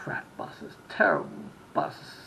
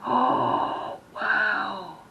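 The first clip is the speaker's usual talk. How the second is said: big, high, drawn-out awed gasp; mock amazement; 7 semitones higher, 7 dB louder than usual. Locals react to hearing Sydney, Australia, loudly amazed. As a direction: imitate wowed locals, louder and higher.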